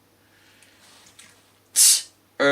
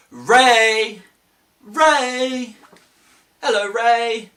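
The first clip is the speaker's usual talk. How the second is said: high, loud call to the rabbit; 12 semitones higher, 6 dB louder, much slower than usual. Pretend. Teacher calling Ray the magic rabbit loudly. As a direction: call him out of the hat. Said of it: "we're gonna call for Ray".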